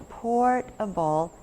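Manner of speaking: "portable" slow, in separate parts; chunked word. Shown as a demonstration. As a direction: say "portable" as port, then able. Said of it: "Can you chunk 'em".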